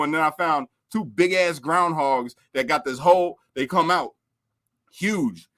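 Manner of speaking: normal talking voice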